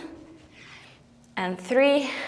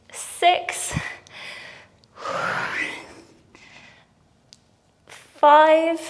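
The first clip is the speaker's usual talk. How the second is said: straining with effort; 5 semitones higher, slower than usual. Real, not acted. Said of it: "'cause my arms, whew, are falling off".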